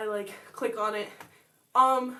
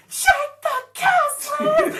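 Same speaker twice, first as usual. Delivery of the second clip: high, loud, drawn-out squeal of a crowd; excited group shout; 8 dB louder, much slower than usual. Retold or imitated. Girl mimics swarming classmates shouting at her, loud and high. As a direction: mimic friends shrieking at her, stretched and laughing.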